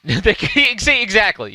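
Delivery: high, loud laugh through the words; laughing along with a chat comment. Real, not acted. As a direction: laugh as you agree. Laughing agreement.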